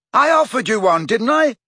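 high, loud protest; heated yell. A narrator's reading. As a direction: throw it back, annoyed. Ron yelling while dangling.

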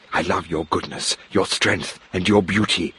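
ordinary delivery

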